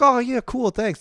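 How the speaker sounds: high, loud, offhand mock thanks; a family member brushing off the gift, mock voice; bored family thanks. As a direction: play it unimpressed and high. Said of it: "they're kind of like".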